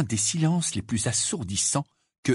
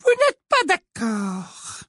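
high, slow, icy sweetness; cold Umbridge; 4 dB louder, much slower than usual. A narrator's reading. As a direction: freeze her out with sugar. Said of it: "son regard devint nettement plus froid". (voix aiguë et glaciale)

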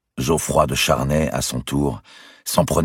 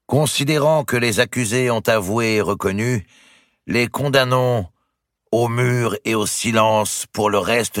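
high, slow judicial proclamation; solemn declamation; 9 semitones higher than usual. A narrator's reading. Papal legate reads the verdict to prisoners and crowd, solemn declamation. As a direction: declaim the sentence, drawing out the words. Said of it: "Le légat prit un temps dans sa déclamation".

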